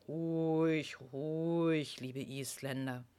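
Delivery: deep, soft soothing voice; calm the frightened herd gently; gentle giant. Acted. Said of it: "sprach mit tiefer Stimme".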